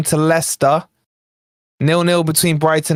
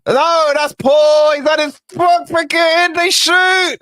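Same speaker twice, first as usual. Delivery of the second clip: excited shout; 9 semitones higher than usual. Real, not acted. Fan yelling as Liverpool shoot on goal. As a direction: shout as the shot goes in.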